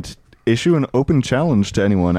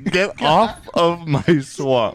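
slow, drawn-out ogre line, pitched up; 7 semitones higher, slower than usual. Pretend. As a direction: stretch it slowly like a playful ogre.

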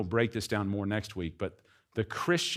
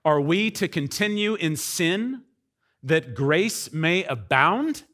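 raised, slow questioning read; quoting Paul's question; 5 semitones higher, 3 dB louder, slower than usual. Retold or imitated. Preacher voicing Paul's question, slow and raised. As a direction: draw out the question as Paul. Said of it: "Paul says".

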